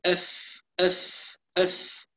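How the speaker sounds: أف with a soft whispered f, gentle pressure; moderate fa. Shown as a demonstration. Teacher showing a moderately pressed voiceless fa. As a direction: breathe out the ف softly in أف. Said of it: "نضغط ضغط معتدل".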